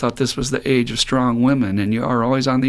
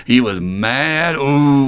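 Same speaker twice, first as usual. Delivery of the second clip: ordinary talk, nothing marked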